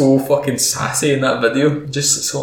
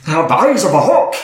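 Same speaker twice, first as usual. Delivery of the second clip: higher, exclaimed dwarf boast; Gimli; 5 semitones higher, 4 dB louder than usual. Acted. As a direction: cry it out as a proud dwarf warrior. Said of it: "guy that plays Gimli".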